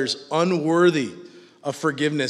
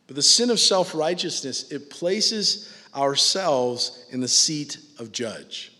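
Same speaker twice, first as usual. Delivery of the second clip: ordinary talk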